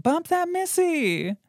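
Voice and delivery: high excited call-out over the music; hyped party shout. Retold or imitated. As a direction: yell it like your song just came on. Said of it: "you're like".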